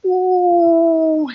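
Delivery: high, loud falling wail; mock scream as she drops; falling cry. Preacher imitating Elsa's falling cry, high and loud. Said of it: "she goes".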